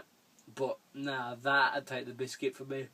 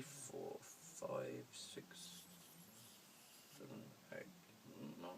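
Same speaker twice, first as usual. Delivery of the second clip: counting under the breath, much softer; whispered counting; 9 semitones lower, 18 dB quieter, slower than usual. Real, not acted.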